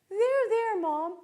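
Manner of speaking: hammed-up comforting teenager; son comforting. Son patting crying mom, mock consoling. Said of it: "He's like".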